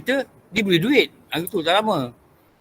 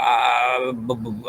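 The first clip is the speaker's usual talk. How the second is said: mock stutter; 6 semitones lower, 4 dB quieter, much slower than usual. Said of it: "Kadang dia besar gagap".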